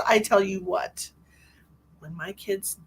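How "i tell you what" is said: usual speaking voice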